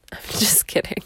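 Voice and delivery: a laughing, whispered "just kidding"; whispered aside. Real, not acted. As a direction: take it back in a quick whisper. Laughing aside after a joke.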